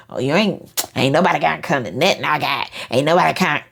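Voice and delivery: sulky, pointed, with an edge; shady. Shown as a demonstration. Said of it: "Don't be shady acting".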